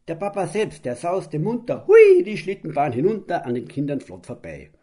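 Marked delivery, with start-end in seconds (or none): 1.9-3.5 gleeful whoop. A narrator's reading. Narrator voices father's sledding whoop.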